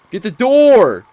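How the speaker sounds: screaming at the game to open the door; real frantic yell at the screen; frantic shout. Real, not acted. Urging door open, now yelling.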